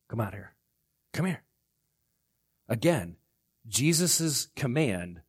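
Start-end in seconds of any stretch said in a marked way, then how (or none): 0.1-1.4 quiet beckoning. Acted. Acts Jesus quietly summoning Peter.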